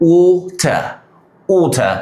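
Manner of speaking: "water" as "waw-tah", clear t, open ending; British water. Shown as a demonstration. Teacher repeating British speech, "water" as "waw-tah". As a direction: say "water" with round "o", no r. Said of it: "ব্রিটিশরা বললো".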